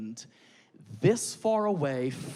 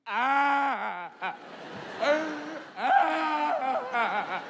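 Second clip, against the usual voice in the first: high fake toddler cry; imitated toddler wail; 10 semitones higher, much slower than usual. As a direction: wail like a whiny three-year-old. Three-year-old crying imitated.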